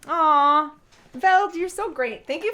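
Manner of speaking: loud high touched coo; real heartfelt coo. Real, not acted. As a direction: melt at a kind message. Touched by viewer's message.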